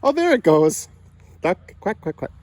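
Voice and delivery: high, loud delighted squeal. Real, not acted. Toddler finally quacks.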